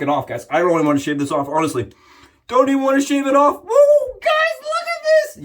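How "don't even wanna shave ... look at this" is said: excited shout. Real, not acted. Vlogger shows viewers the lather, excited shout. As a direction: cheer at the lather like a fan.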